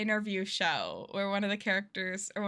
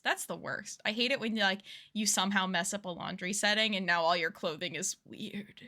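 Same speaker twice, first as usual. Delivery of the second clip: ordinary talk, nothing marked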